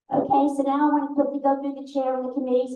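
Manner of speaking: rushing through quickly; fast talking. Real, not acted. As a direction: speed through the list. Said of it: "I'm trying to talk as fast as I can".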